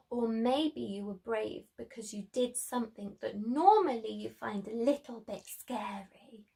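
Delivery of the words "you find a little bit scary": soft unvoiced whisper. Real, not acted. Teacher whispers about scary things to the children. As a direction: hush it like a secret. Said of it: "whispered aside to children".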